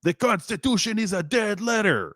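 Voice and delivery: high-pitched mock yell; retold yell. Activist declaring the Constitution dead, retold yell. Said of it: "he yells".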